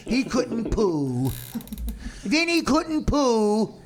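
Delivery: put-on slow, high baby-talk whine; mocking dog-owner voice. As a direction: stretch it out like cooing to a pet. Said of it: "Dan go".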